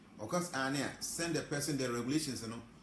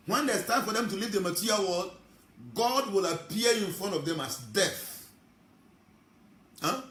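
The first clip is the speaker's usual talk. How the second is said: preaching; 6 semitones higher, 6 dB louder than usual. Acted. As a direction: thunder it from the pulpit. Preacher warns of death.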